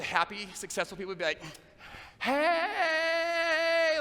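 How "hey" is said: much higher and louder showy hey; cheery greeting. Shown as a demonstration. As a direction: call out like a beaming success story. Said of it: "like that".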